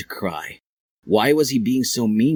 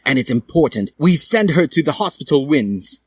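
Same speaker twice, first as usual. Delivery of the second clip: raised character voice; 8 semitones higher, 3 dB louder than usual. A narrator's reading. Dumbledore speech.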